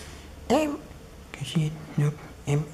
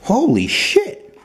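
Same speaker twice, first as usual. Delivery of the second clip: high, slow, louder holy shit; genuinely startled outburst; stretched shocked yelp; real shocked exclaim; 12 semitones higher, 6 dB louder, much slower than usual. Real, not acted. Power button pressed, reaction.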